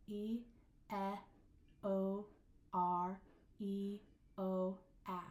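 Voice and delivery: vowels of "E, E, O, R, E, O, A" each said alone; isolated vowel sounds. Shown as a demonstration. Teacher counting vowel sounds, isolated and spaced. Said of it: "Let's count our vowel sounds".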